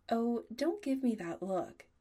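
playfully exasperated telling-off; mild scolding. A narrator's reading. Adam to pouting Chris, chiding.

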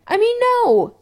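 high, loud panicked correction; flustered denial. A narrator's reading. Chris correcting his own yes, panicked yelp.

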